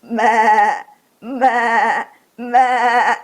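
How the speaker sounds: loud bleating meh; goat bleat. Acted. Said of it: "What kind of sound do goats make".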